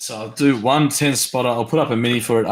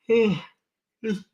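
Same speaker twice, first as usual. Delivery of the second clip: yawning; 9 semitones higher than usual. Real, not acted. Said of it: "now I'm starting to yawn again".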